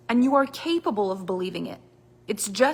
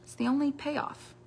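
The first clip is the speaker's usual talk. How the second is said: usual speaking voice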